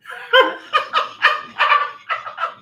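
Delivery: shrieking laugh, rising; high squealing laugh. Real, not acted. Mask mishap, big laugh.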